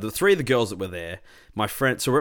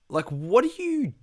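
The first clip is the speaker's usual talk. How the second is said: high, slow challenge; 12 semitones higher, slower than usual. Retold or imitated. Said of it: "I said to her, I'm like".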